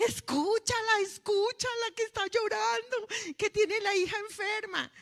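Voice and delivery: put-on high, loud child's plea; urgent high little-girl pleading; little girl begging Jesus. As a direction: tug at his robe and beg like a small girl. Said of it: "yo me le acercaba y le jalaba la bata a Jesús".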